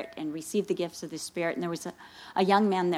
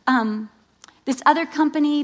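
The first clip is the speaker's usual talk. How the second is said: normal talking voice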